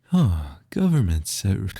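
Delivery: loud anime character voice; VTuber anime voice. Shown as a demonstration. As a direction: play it like an anime streamer. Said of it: "my VTuber anime voice".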